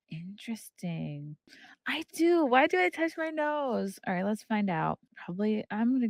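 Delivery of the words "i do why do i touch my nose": high, startled self-question; surprised. Real, not acted. Noticing her own habit in a startled voice. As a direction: suddenly catch yourself doing it. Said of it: "self-surprised question".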